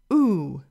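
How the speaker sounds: "oo" drawn out as in "pool"; isolated long "oo" for learners; long U sound. Shown as a demonstration. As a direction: hold "oo" like the vowel in "soup". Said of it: "This is our long U sound".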